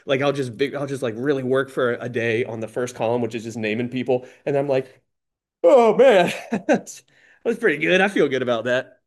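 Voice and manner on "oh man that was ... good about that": pleased with himself. Retold or imitated. Self-satisfied after one day's work.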